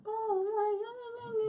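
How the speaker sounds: very high falsetto wail; hammed-up high-pitched lady; mock woman's voice. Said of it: "the stupid woman goes".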